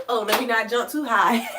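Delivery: usual speaking voice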